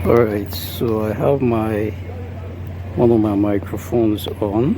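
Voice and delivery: windy outdoor recording on wireless mic; talking outside in strong wind, mic close. Real, not acted. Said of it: "this is a very windy area".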